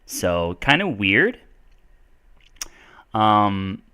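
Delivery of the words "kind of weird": California uptalk, endings rising like questions; California accent. Shown as a demonstration. Teacher demonstrating California speech to viewers, California accent with uptalk. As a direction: do a put-on Californian lilt, inflecting up on each phrase. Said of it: "when I speak in a California accent".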